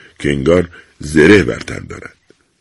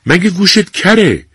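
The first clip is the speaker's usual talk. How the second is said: raised, slow, impatient yell; shouted question; 13 semitones higher, 4 dB louder than usual. A narrator's reading. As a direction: call out sharply at a deaf old woman. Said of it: "کارمند فریاد کشید".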